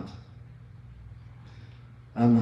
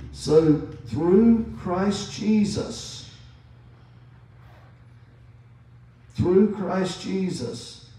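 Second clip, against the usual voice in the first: slow, drawn-out through, voice raised; stress on through; 5 semitones higher, much slower than usual. Shown as a demonstration. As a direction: linger on through, higher and slow. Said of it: "that word through".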